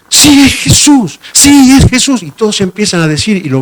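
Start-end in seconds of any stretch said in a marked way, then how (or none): 0.1-2.2 high, loud, eager yes; excited agreement. Pretend. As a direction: join in the excitement, convinced. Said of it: "los demás miran y dicen".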